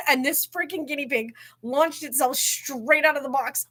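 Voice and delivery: ordinary talk, nothing marked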